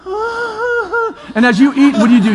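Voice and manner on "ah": a high, breathy gasp of wonder; playful mock "ah" of awe; awed sigh. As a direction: sigh "ah" as if amazed. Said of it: "everybody else around you goes".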